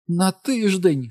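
high, drawn-out theatrical wail; aghast, high-pitched and slow; shrieked disbelief. A narrator's reading. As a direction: cry out like a bad-opera diva. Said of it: "скрикнула вона".

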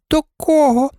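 very high, surprised doll question; puzzled doll. A narrator's reading. Doll asking the dog whom he means, very high and puzzled. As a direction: squeak it as a baffled query. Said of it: "перепитала одна з ляльок".

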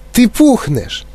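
high, horrified exclamation; crying out. A narrator's reading. Mother sees Violet swelling, crying out. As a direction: blurt it out in alarm. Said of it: "закричала пані Борегар".

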